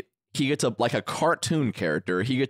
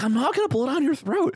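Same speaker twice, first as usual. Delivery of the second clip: much higher, offended refusal; a higher, mock-offended friend refusing; mock indignant refusal. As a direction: squeak out the refusal. Aiden refusing, quoted.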